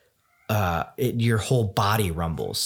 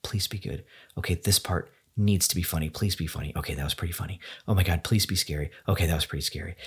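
softer, low nervous self-talk under the breath; quietly begging the movie to be good; hushed, tense muttering to yourself in the theater; anxious inner pleading; 5 semitones lower, 5 dB quieter, faster than usual. Retold or imitated. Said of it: "And I was like".

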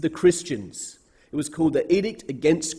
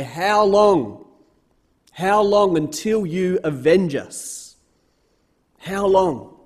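raised, pleading cry of slain saints; 3 semitones higher, 3 dB louder, slower than usual. Acted. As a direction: beg God loudly, drawn out, slower. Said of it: "They're crying out to God and they say".

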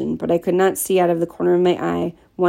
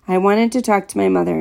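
usual speaking voice

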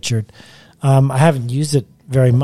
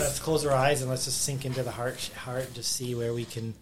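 guided meditation; 13 dB quieter than usual. Acted. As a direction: quietly lead them into calm. Leads a heart meditation.

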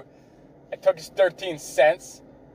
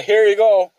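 louder, higher, fake cheery; mock friendly toss; 6 semitones higher, 7 dB louder than usual. Barista tossing a smashed Timbit, fake-cheery and loud. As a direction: hand it over with fake sweetness. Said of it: "It was just a".

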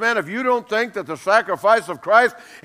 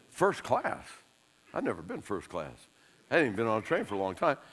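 amazed aside; 9 semitones lower, 12 dB quieter than usual. Retold or imitated. Surprised at train offer.

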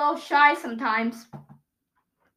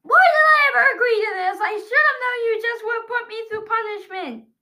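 exasperated complaint; 7 semitones higher, 3 dB louder than usual. Real, not acted. Tape removed, complaining.

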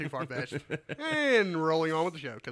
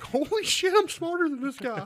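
much higher and louder, laughing disbelief; shocked realization; 13 semitones higher, 7 dB louder than usual. Pretend. Patient realizing the Alabama doctor is dumber, in amazed laughing disbelief. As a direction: blurt it out like you just caught the doctor being dumb. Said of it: "you're gonna be like".

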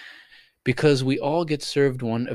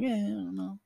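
unsure shrug; 9 semitones higher, 9 dB quieter than usual. Retold or imitated. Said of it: "Or you're still kinda like".

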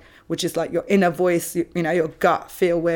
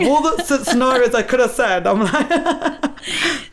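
higher, louder, slow laughing words; 7 semitones higher, 4 dB louder, slower than usual. Real, not acted. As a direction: giggle through it. Laughing at the coincidence.

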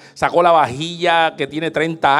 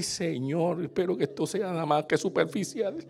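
much softer, a half-whispered plea; hushed mock prayer; 10 dB quieter than usual. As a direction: pray under your breath that it's only on top. Prayer over burnt lasagna. (oración en voz baja, casi susurrada)

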